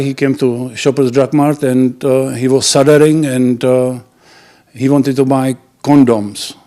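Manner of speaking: ordinary talk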